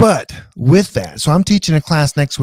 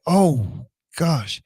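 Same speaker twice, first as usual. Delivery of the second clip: mock surprise; 3 semitones higher than usual. Retelling her shock at the fifth-grade rewrite, mock surprised. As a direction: replay being caught off guard. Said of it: "I was surprised".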